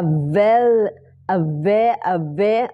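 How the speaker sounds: "avail-" broken off after a long A; A-sound pronunciation. Shown as a demonstration. Teacher isolating the A for learners, cut-off syllable drill. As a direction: drill the first part of "avail-", holding the A. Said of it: "ออกเสียงเป็น A นะคะ".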